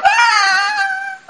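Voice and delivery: very high, loud scream of wild release; joyful scream. Retold or imitated. Running from the angry friend, screaming with joy. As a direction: let out a shrill playful scream. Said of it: "started to scream".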